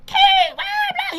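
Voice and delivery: a sudden high yell from the crowd; heckler's shout. Retold or imitated.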